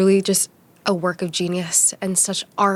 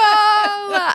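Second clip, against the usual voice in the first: bright high laugh; high giggle; 14 semitones higher, 4 dB louder than usual. Real, not acted. Laughing at own reaction.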